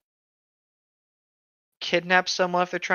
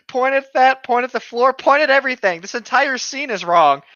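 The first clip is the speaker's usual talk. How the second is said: exasperated rant; 8 semitones higher, 5 dB louder than usual. Real, not acted. Exasperated rant about the scene.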